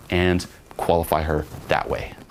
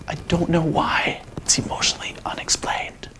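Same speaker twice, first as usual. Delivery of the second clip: hushed, put-on wonder; soft, unvoiced-sounding breath of mock amazement; mystified whisper; 8 semitones higher, 4 dB quieter than usual. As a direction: whisper it like a baffling secret. Acted wonder at unexplained attraction.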